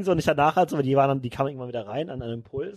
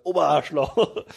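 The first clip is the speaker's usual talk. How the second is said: mock teacher insult; much slower than usual. Lehrerbeschimpfung nachgespielt.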